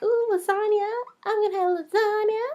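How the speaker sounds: high, excited mock craving; mock delight. Playing the lasagna lover, high delighted coo. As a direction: coo over it like a treat. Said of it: "you're like".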